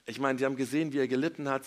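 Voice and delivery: usual speaking voice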